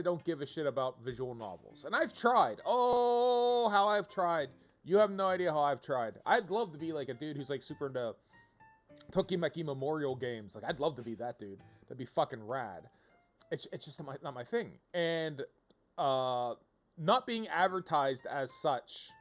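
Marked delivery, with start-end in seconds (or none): none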